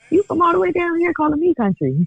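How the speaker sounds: high-pitched disbelieving retort; mock indignation. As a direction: throw it back, high and affronted. Retells her indignant comeback.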